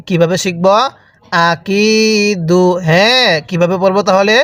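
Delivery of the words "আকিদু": slow "আকিদু" with a long held কি; drawn-out vowel. Shown as a demonstration. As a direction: stretch the কি in "আকিদু". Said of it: "এরকমভাবে পড়ব".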